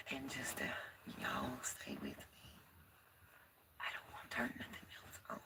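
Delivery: very soft, frightened whisper; scared whisper. Real, not acted. Vlogger alone on first night, confiding in viewers, whispering. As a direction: whisper as if hiding in the dark. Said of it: "I'm scared".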